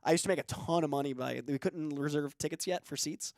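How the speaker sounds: normal talking voice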